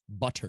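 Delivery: "Butter" with a crisply sounded t; over-proper "Butter"; proper enunciation. Real, not acted. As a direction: enunciate "Butter" carefully, no flapped t. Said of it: "It's proper enunciation".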